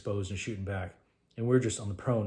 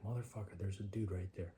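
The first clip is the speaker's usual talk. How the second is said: hushed; 11 dB quieter than usual. Retold or imitated. Spotting enemy in the dark.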